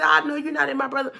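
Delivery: retold confrontation. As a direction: square up and fire it back quick. Confronting brother's opponent.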